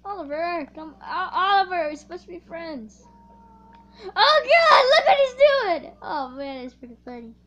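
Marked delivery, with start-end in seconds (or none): 4.2-5.9 high loud cry of shock; shocked shout. Real, not acted. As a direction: shriek in disbelief. Shocked at teammate's action.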